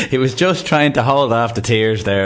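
talking through laughter; laughing. Real, not acted. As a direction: chuckle as you tease the caller.

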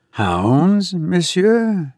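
high, rising note of surprise; puzzled question. A narrator's reading. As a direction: query it in disbelief. Puzzled challenge to companion's story.

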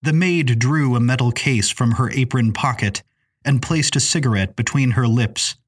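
ordinary delivery, nothing marked

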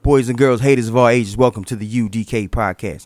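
loud showman welcome; grand entrance announcement. Acted. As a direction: open the show like an emcee. Host opens the show.

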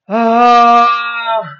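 loud, open ah from the belly. Shown as a demonstration. Speaking coach showing the belly voice, loud open ah. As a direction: let a big ah out from the gut. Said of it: "the voice is coming from the belly".

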